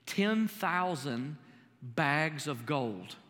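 ten thousand bags of gold, drawn out very slowly; slow emphasis. Real, not acted. Preacher to congregation, dwelling on the debt, very slow and weighty. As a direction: lean on each word to make the sum feel huge. Stretches the huge sum.

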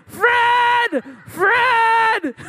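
high, loud desperate yells; frantic shouting. Retold or imitated. Mom yelling for her husband from a carriage, frantic shouts. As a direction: shout his name across the park. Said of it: "And my mom's like".

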